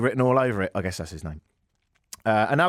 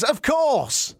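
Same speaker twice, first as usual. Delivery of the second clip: high, drawn-out joyful cry; hammed-up delighted proposal answer; elated acceptance; 13 semitones higher than usual. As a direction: burst out with a gushing yes. Acted joyful yes to proposal.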